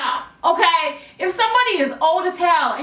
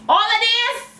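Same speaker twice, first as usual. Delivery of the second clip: YouTube persona; 8 semitones higher than usual. Shown as a demonstration. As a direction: stretch it out in performer mode. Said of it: "is because I'm by myself in my room".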